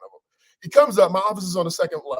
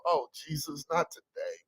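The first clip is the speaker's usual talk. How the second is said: soft, sinking mock dread; weary groan; 8 dB quieter, slower than usual. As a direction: sigh it out under your breath. Dreading someone approaching.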